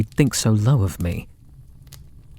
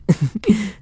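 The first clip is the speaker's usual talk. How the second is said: high giggle; 10 semitones higher than usual. Acted. Captor reveals gift collar.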